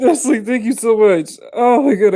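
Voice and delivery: high, loud, excited thanks; overwhelmed gratitude. Real, not acted. Streamer thanking the tipper, overwhelmed excitement.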